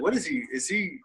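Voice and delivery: voiced baffled reaction; mock bafflement. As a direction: lightly imitate people wondering what's wrong with him. Acting out baffled listeners.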